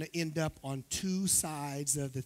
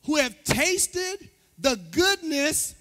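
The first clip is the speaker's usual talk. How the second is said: slow, raised pulpit reading with pauses; scripture reading; 11 semitones higher, 9 dB louder, much slower than usual. Acted. Pastor reads Hebrews to the church, proclaiming it. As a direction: proclaim the verse phrase by phrase.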